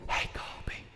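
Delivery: hushed unvoiced whisper across the table. Friend whispering to Colby at lunch, hushed whisper. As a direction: whisper so the teacher can't hear. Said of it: "and he said".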